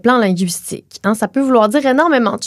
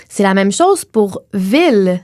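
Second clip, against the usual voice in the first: ordinary talk, nothing marked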